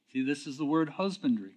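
ordinary talk

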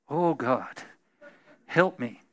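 a hint of a frightened whispered prayer; mock helpless prayer; desperate plea. Panicked prayer after report.

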